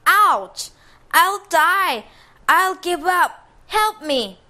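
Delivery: high, loud pleading yells; panicked pain cries. Acted. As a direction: howl in pain and beg. Ogre stabbed from inside.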